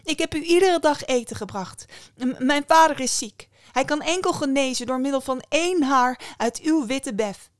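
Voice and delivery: high, loud pleading with a stammer; urgent plea. A narrator's reading. Young woman begging the bear for her sick father, urgent plea.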